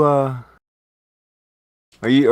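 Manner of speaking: ordinary talk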